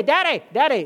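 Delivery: put-on high, loud whiny child calling daddy; kid whining for daddy. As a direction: whine it like a sleepy kid at night. Kid calling dad at night.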